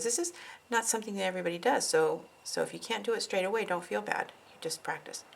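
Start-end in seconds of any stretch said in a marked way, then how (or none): 4.5-5.2 talking while chewing gum; words around a wad of gum. Real, not acted. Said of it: "Sorry, I have gum too".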